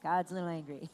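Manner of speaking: understated gloomy reading; mock grim. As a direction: drop the voice like reading a dark warning. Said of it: "and it's like".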